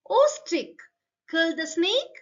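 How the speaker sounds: high, loud call. Acted. Sparrow calling to the stick, high and loud. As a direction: cry out to the stick.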